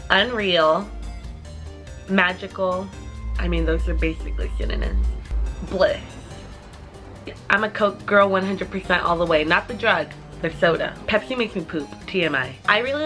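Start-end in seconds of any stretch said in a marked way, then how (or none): none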